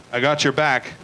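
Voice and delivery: low, casual buddy line; friend's voice. Retold or imitated.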